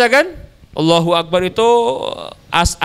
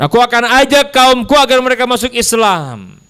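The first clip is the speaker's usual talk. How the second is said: higher-pitched, fervent retold declaration; rousing vow; 7 semitones higher than usual. New convert promising to bring his people to Islam, rousing vow. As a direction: proclaim the pledge as the retold chief.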